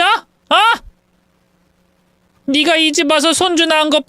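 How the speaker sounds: venomous mother-in-law accusing her son's wife; furious mother-in-law. Acted. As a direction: tear into the daughter-in-law while pulling her hair. Mother-in-law rant continues.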